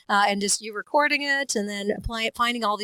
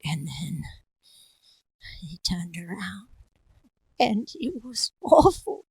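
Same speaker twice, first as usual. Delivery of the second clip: slow whisper building to a wail; creepy tale told slowly; spooky storyteller; 2 semitones lower, slower than usual. Acted. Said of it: "other ones was like".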